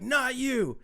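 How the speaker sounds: high, drawn-out mock yell; pissed-off dad. Dad stopping his son from fleeing, imitated angry yell. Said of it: "Dude comes home super pissed".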